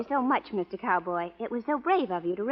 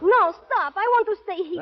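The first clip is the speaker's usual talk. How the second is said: loud, high, pleading to be let go; frightened protest; 10 semitones higher, 7 dB louder than usual. Acted. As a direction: push him off in alarm. Woman struggling against pushy cowboy.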